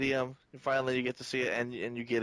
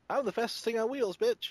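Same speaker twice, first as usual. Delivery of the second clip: put-on much higher, brash young-bot voice; cocky young Prowl; 10 semitones higher than usual. A fan imitates brash young Prowl, pitched much higher. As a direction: toss it off like a cocky kid racer. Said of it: "young Prowl sounded a lot like Bumblebee".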